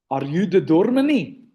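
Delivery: put-on a fair stab at a heavy Afrikaner accent; thick Afrikaner accent. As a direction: mimic an Afrikaner man asking "doormani". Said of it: "A thick Afrikaner accent".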